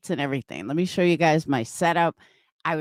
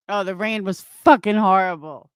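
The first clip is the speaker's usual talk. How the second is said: normal talking voice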